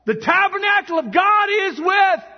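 high, booming drawn-out shout; loud heavenly proclamation. A narrator's reading. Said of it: "I heard a loud voice from heaven saying".

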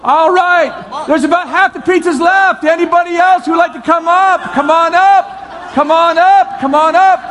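host urging stragglers forward; calling out. Real, not acted. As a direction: beckon the whole room up. Inviting more people up.

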